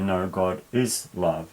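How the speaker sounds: "is" stressed, said slowly as a rebuttal; contradicting stress. Shown as a demonstration. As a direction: insist on "is", like answering someone who denied it. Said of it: "English stress like that would often imply".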